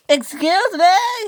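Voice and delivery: loud, high, drawn-out call. A narrator's reading. Millie calling to Spencer for help, high drawn-out shout. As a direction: hail a passing engine, stretching it out. Millie calls out to passing engine.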